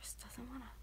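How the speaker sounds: very soft, near whisper; whispered aside. Real, not acted. Telling viewers boyfriend won't wake, whispered aside. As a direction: drop to a hushed aside.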